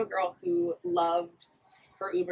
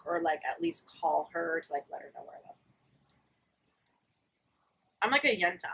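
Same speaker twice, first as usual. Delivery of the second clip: normal talking voice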